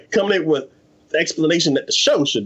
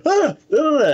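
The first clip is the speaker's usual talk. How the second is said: much higher startled yelps; mock startled cry; 12 semitones higher than usual. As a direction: yelp in fright at the grinning demon. Spirit startled by demon girl.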